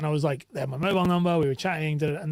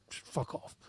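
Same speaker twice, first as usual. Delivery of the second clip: whispered retold swear; 15 dB quieter than usual. Retold telling client off.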